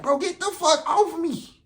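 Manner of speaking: mock choked plea; strained while choked. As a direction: force the words out under a chokehold. Being held in chokehold.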